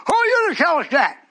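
high, loud indignant retort; mock challenger. Preacher voicing listeners who reject him, loud and high. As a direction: bark it back like an offended listener.

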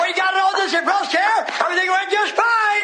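high, driving revival preacher; full-force preaching. Real, not acted. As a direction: ride each phrase up high and ring it out.

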